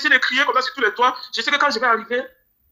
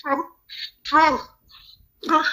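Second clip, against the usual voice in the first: voice breaking with grief; 3 semitones higher, 3 dB quieter, much slower than usual. Real, not acted. Grieving son missing his mother.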